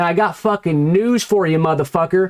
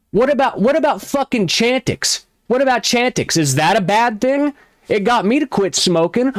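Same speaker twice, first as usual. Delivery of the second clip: ordinary talk, nothing marked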